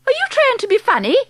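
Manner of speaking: high, quick and prim. A narrator's reading. Mrs Jenkins challenging the grandmother, high and prim. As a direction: voice an indignant, prim wife. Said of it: "very prim".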